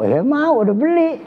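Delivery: high, squeaky mimic of the student; mocking student voice. Teacher mocking a student who wants something, in a squeaky high voice. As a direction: parrot the student back in a silly high voice.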